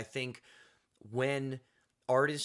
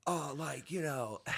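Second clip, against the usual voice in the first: San Diego surfer voice; 5 dB quieter, slower than usual. Retold or imitated. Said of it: "San Diego surfer voice".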